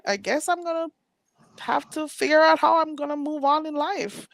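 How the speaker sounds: high mock-dramatic sarcasm; sarcastic. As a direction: play it fake-heartbroken. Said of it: "And I'm like".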